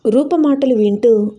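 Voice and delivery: plain reading voice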